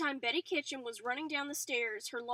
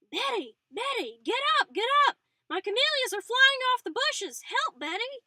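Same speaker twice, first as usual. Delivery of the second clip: high, loud panicked shrieks for Betty; frantic screaming; 11 semitones higher, 12 dB louder than usual. A narrator's reading. Old mother screaming for her daughter in the hall, frantic high shrieks. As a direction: scream it at the top of your lungs. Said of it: "screaming for her daughter at the top of her lungs".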